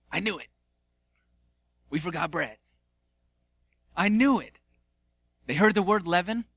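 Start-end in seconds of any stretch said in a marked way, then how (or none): 0.1-4.6 flustered disciples. Acted. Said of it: "the disciples are like".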